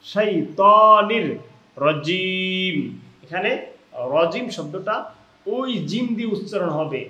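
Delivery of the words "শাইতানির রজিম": slow recitation, long loud "too" in শাইতানির; drawn-out Arabic recitation with rounded "too"; heavy to. Shown as a demonstration. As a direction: stretch শাইতানির and hold the end of রজিম. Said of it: "আমরা এখানে বলবো".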